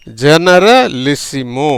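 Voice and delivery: ஜெனரலிசிமோ stretched out very slowly, each syllable clear, rising at the end; slow model word. Shown as a demonstration. Teacher slowly models ஜெனரலிசிமோ for the class. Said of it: "ஜெனரலிசிமோ அப்படிங்கிற உச்சரிப்புல".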